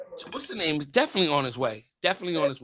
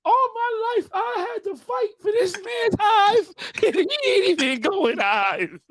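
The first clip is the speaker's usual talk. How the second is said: mock lament; slower than usual. Mock lament, high far voice.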